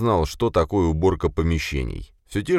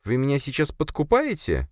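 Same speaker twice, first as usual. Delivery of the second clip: astonished; 10 semitones higher than usual. A narrator's reading. Sofia asks boss about bribery, astonished. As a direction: ask high, thrown by the offer. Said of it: "Софья была удивлена и обескуражена".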